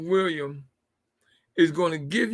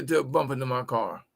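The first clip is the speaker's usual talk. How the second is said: normal talking voice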